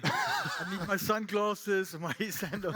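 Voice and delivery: chuckling through the words, slowed down; laughing. Real, not acted. Joking to the panel about his outfit, laughing. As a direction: laugh at your own outfit as you say it.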